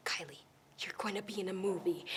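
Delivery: whispered secret news. Retold or imitated. Mom telling daughter the news, whispered. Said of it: "my mom says".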